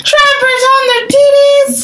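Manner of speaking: gleeful high-pitched repeat; high excited squeal. Real, not acted.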